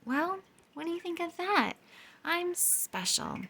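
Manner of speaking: proud Pluto. A narrator's reading. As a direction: savour it, proud and high. Pluto pleased.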